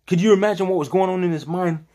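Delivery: normal talking voice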